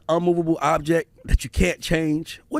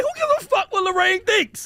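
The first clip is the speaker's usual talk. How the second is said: incredulous retort. Retold or imitated. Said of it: "I'm like".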